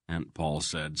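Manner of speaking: ordinary delivery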